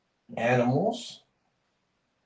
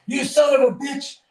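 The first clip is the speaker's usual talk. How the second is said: much higher, louder angry shout; a cheated bartender shouting, much higher and louder; furious bartender; 13 semitones higher, 6 dB louder than usual. Retold or imitated. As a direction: yell at the man who cheated you. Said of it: "walks right over to this guy and says".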